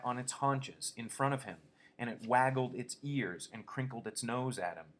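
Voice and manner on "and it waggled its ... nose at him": plain reading voice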